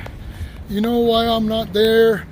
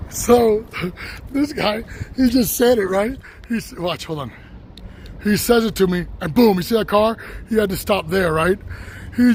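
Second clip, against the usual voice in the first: usual speaking voice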